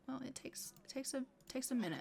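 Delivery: voicing the girl. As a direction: rush out the excuse in a small high voice. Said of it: "She's like".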